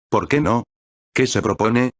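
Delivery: ordinary delivery, nothing marked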